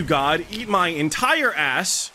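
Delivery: loud, slow furious insult; angry shout. Real, not acted. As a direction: drag the insult out angrily. Raging at griefing player.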